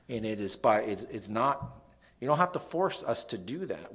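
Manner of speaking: ordinary delivery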